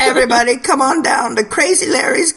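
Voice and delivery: high-pitched huckster pitch; store ad voice-over. Acted. Host improvising an ad for a seedy local clock store, high huckster voice. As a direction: hawk it like a crazy local salesman. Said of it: "perfect V O for your local clock store".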